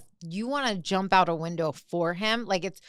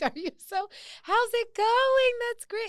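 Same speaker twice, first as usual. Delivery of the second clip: much higher, gushing fake-cheerful voice; tone-deaf chirpy manager. Imitating the manager gushing to the divorcing coworker. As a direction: gush like an oblivious boss.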